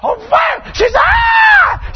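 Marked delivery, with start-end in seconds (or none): 0.0-0.7 high-pitched re-enacted yell; startled shout. Preacher re-enacting the shock mid-message, startled shout. 1.0-1.9 very high, piercing imitated scream; sudden high shriek, imitating a woman; imitated woman's shout. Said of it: "She shouted".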